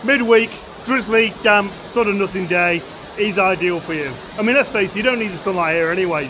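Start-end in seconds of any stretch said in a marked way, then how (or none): none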